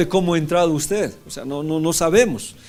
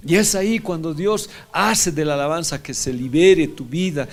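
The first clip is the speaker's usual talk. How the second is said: ordinary delivery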